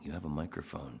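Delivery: ordinary delivery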